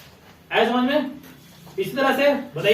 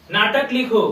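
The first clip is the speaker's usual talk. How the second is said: ordinary delivery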